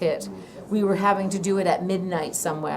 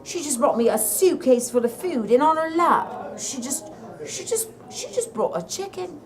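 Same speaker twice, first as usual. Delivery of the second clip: light Irish lilt, retelling the DJ; a hint of an Irish DJ telling his story; Irish accent; 2 semitones higher than usual. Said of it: "in an Irish accent".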